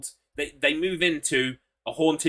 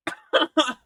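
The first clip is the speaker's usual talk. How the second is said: high cackling laugh; 11 semitones higher than usual. Real, not acted. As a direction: burst into a high helpless cackle. Still laughing.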